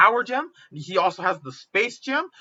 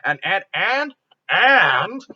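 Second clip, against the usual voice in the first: louder, holding the floor with each word; insistent; 7 dB louder, much slower than usual. Real, not acted. Pitching a film plot and refusing to stop, insistent. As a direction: push each "and" harder to keep going.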